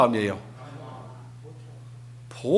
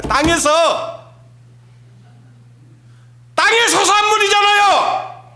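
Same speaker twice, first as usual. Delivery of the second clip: much higher, loud preaching shout; preaching at full force; 12 semitones higher, 6 dB louder, slower than usual. Acted. Preacher shouting to the congregation, full-force preaching. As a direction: thunder it from the pulpit.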